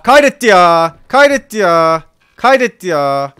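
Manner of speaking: loud, high, alarmed cries; real panicked yelling, pitch up; panicked shout. Real, not acted. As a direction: yell it in sudden panic. Game autosaved, panicked outburst.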